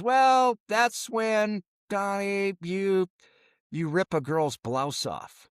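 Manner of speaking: high, halting mock-dad voice; dad stalling before explaining to his son; awkward dad explaining. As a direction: fumble through it like an embarrassed father.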